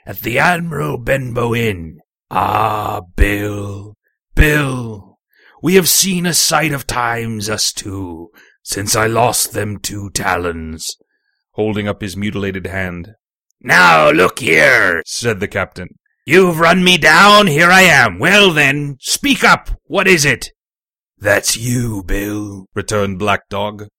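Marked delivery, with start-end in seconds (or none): 2.3-11.0 low, slow reminiscing sailor voice; slow, low old sea-rogue. Acted. 13.6-15.0 loud, blustering captain voice; impatient captain, forceful and loud; gruff captain. Acted. 16.2-20.5 put-on loud, blustering old captain; forceful, impatient sea captain; captain, challenging. 21.2-22.7 low sly shipmate voice; lightly voiced low sea-rogue.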